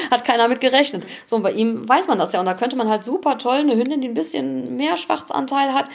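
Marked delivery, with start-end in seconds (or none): none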